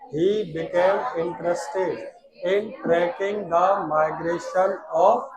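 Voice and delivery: very slow, calm, word by word; slow dictation. Shown as a demonstration. Teacher leading students in slow calm recitation. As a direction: lead the class through it slowly. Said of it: "ध्यान से आराम से बोलना".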